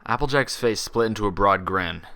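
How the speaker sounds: ordinary delivery, nothing marked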